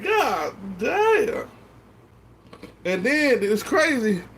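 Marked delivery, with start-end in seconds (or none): 0.0-1.5 mock exclaim. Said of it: "I said".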